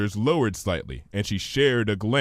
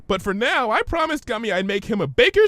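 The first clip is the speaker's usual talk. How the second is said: much higher, bubbly pony; Pinky's voice; 12 semitones higher, 3 dB louder than usual. Acted. Pinky puzzled by Twilight's big word, high voice.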